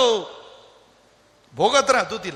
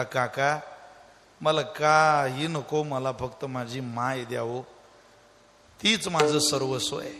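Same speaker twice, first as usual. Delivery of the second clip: quiet tearful plea; 5 semitones lower, 4 dB quieter than usual. Acted. Lost child refuses gifts, wants mother.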